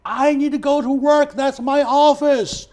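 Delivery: loud, high, urgent plea, slowing on the last words; urgent retold plea. Retelling how he pleaded with the officer blocking his way, loud and urgent. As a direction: call out to the armed officer that you just need to get to work.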